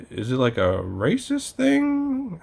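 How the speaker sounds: high, slow, puzzled question; confused dad. Retold or imitated. Imitating his dad asking about the bumping, slow high puzzled. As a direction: ask it slowly and unsure. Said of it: "he's like".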